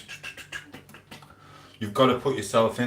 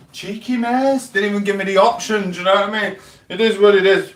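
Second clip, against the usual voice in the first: high mock indignation; 11 semitones higher, 7 dB louder than usual. Retelling his reaction to being moved aside at hospital, high mock squeal. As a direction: play it as a put-on high squeal of cheeky outrage. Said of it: "I was like".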